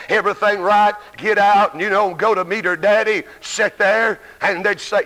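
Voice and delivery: normal talking voice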